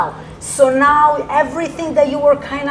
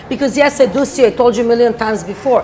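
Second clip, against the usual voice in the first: ordinary delivery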